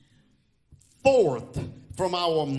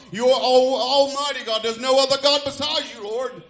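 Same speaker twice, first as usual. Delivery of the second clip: praying aloud, building higher and louder; fervent prayer; 4 semitones higher, 4 dB louder than usual. Acted. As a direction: build from a call on God into raised praise. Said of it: "they started praying something like that".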